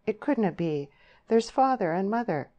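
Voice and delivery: a touch of helpless despair; hopeless. A narrator's reading. Deb raising her parents as an obstacle, hopeless. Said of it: "said Deb hopelessly".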